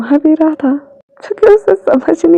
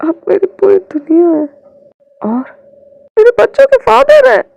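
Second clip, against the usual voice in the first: building to a high, loud, swelling cry; rising emotional declaration; 8 semitones higher, 6 dB louder than usual. Acted. Partner declaring devotion to husband, rising emotional cry. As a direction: let the love burst out on the last words.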